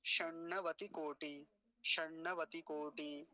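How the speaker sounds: slow शर्णवती कोटि with retroflex n and ṭ; full-word model. Shown as a demonstration. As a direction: join shaṇṇavatī koṭi, syllables held. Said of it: "पूरा मिलके क्या बनेगा".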